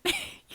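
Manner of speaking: quick bright burst of laughter; sudden high laugh; short high laugh. Real, not acted. Laughs at herself.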